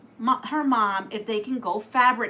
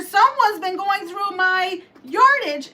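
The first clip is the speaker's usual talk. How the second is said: high, louder mock outrage; Papa Bear voice; 9 semitones higher, 5 dB louder than usual. Papa Bear checking his fabric, mock outrage. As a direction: huff about the yardage.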